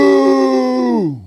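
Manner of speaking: long, high, loud boo; heckler's boo. Retold or imitated. Heckler to the comic on stage, loud drawn-out boo. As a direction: boo loudly and draw it out high. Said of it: "you just hear her go".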